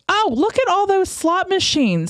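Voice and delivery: mock a clueless moviegoer in high-pitched Jersey; New Jersey accent. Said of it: "I always give them a New Jersey accent".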